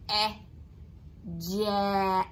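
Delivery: each sound "n", "a" said separately, then blended into "nut". Shown as a demonstration. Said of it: "slide them together".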